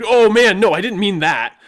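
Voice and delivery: high, loud flustered backpedal; panicked protest. Retold or imitated. Player backpedaling to the GM, loud and panicked. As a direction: squeal it out in alarm.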